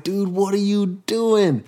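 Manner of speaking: high, slow, stretched-out plea; exasperated disbelief. Retold or imitated. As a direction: retell your dismay, dragging the words out high. Retold reaction to meth on him.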